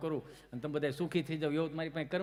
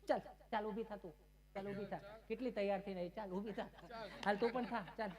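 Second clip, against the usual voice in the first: softer, higher, put-on lady voice; lady urging her friends to get up; bossy lady; 4 semitones higher, 8 dB quieter than usual. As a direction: hurry the friends up.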